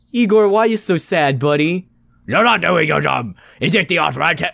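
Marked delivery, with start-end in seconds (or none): 2.3-4.5 gruff mock scolding for the dog; a touch of put-on anger; angry voice. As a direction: voice the dog as a stern grump. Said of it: "I'm sorry I gave you an angry voice".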